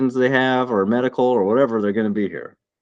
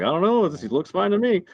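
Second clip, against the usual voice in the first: higher-pitched, offhand doctor quote; mock doctor in a raised pitch; lightly voiced doctor; 9 semitones higher, 3 dB quieter than usual. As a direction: lightly imitate an unbothered doctor. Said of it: "the doc was like".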